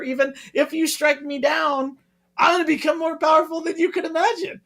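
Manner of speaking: lightly voiced wise old Jedi; quoting Obi-Wan. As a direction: rattle it off high as a playful Obi-Wan. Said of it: "He says to Vader".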